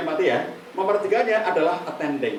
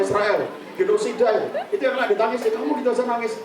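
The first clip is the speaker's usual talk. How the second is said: dismissive husband; 6 semitones higher than usual. Acted. Husband to crying wife, dismissive scolding. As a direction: brush her tears off as drama. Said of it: "suami yang tidak ngerti kesedihan istrinya".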